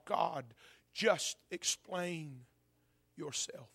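slow, low, halting plea to God; hushed crying out. Acted. Preacher as Job crying out to his God, hushed plea. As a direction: plead softly, leaving gaps between words. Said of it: "he's crying out to his God".

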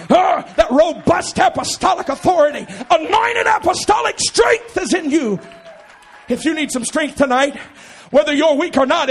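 loud, high, impassioned preacher delivery; full-force preaching. Real, not acted. Preacher rousing the congregation to stand and praise, full-force preaching. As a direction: build to a shouted, soaring peak.

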